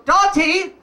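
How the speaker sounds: high, loud call of a name; urgent call to a stubborn friend. Retold or imitated. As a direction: call her sharply across the truck. Calling out to friend.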